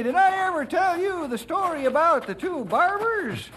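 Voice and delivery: put-on high-pitched old-timer eager to tell a joke; old-timer storyteller.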